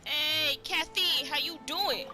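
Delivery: ordinary talk, nothing marked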